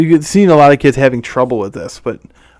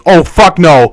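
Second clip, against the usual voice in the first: higher, louder acted-out objection; loud outraged outburst; 4 semitones higher, 6 dB louder, slower than usual. One of the Andes comes in and objects, mock outraged shout. Retold outburst of man barging in.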